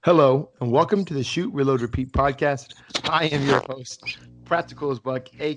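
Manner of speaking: hosting a radio show, big welcome; announcer-style podcast intro. Pretend. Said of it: "making fun of my radio voice".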